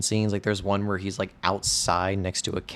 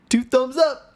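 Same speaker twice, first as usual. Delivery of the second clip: very high, louder mock-awkward exclamation; imitated awkward catchphrase; 5 dB louder than usual. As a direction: overdo the cheesy catchphrase, pitched way up. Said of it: "he does it really awkwardly".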